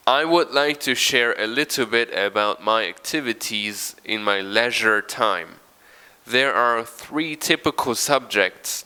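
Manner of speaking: loud, careful read-aloud of the paragraph. Acted. Teacher reads the paragraph to the class, loud and clear. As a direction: read it out like a model text.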